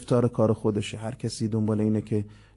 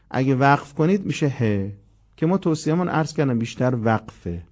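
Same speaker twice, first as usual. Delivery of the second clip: ordinary talk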